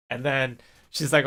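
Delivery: usual speaking voice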